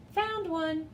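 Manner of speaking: announcing the frog find; announced. A narrator's reading. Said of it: "Maury announced".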